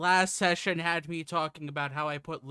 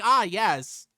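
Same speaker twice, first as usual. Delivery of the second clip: whiny voice; 9 dB louder than usual. Shown as a demonstration. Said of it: "pitch my voice up and just put on my biggest whininess".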